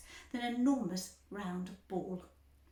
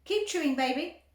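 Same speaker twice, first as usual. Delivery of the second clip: Mister Beauregard; 5 semitones higher, 7 dB louder than usual. A narrator's reading. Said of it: "said Mister Beauregard".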